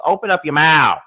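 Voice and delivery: fed-up holler at someone absent; exasperated yell. Pretend. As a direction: raise your voice as if telling off a mumbler. Scolds absent Andy for mumbling.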